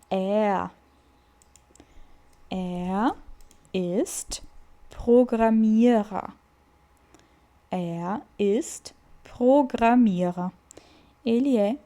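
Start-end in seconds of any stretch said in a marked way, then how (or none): 2.5-10.5 Er ist Programmierer drawn out slowly; slow model. Shown as a demonstration. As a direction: stretch every syllable for the learners. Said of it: "teacher models sentence".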